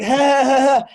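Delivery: high, forced, hollow laugh; flat awkward ha ha ha; mock fake laugh. Host girl covering with a forced laugh, high and fake. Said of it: "she's like".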